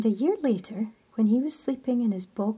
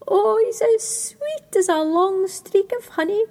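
higher, louder, delighted little cry; 9 semitones higher, 6 dB louder than usual. Acted. Lady creeping things admiring the sleeping boy, higher delighted cry. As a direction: gush like tiny admiring creatures. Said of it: "cried the lady creeping things".

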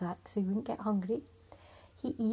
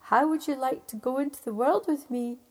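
louder call up to a giant; calling up loudly; 3 semitones higher, 7 dB louder than usual. Acted. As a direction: keep shouting up to him. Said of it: "he shouted so the giant could hear".

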